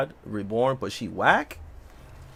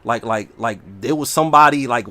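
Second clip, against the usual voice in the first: ordinary delivery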